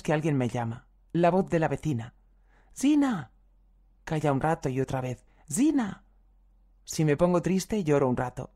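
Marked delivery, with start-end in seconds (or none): 2.7-3.3 high, falling call of a name. Retold or imitated. In a dream the neighbour calls her name in a high, drawn call. 5.5-6.0 high, falling call of a name. Retold or imitated. In a dream the neighbour calls her name in a high, drawn call.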